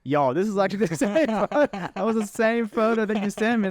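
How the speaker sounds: excited retelling, pitched well up; amused amazement. Retold or imitated. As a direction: relive the thrill of recognising it. Retells recognising the photo, laughing.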